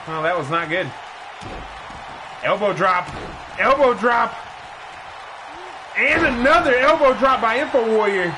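brisk ringside call, rising with the action; play-by-play commentary. Acted. Commentator calling the match for viewers, energetic play-by-play. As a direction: call the match like a wrestling announcer.

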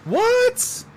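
sharp high surprised what. Real, not acted. Player hears friend's birthday, high surprised what. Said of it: "surprised at birthday".

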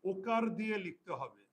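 slow and spaced for writing down; teacher dictating the rule to pupils, slow; slow dictation. Shown as a demonstration. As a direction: dictate word by word.

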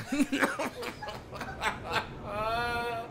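muffled laugh with a full mouth; real breathless giggling; helpless laugh. Real, not acted. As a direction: laugh at your own stupidity. Laughing at own dumb idea.

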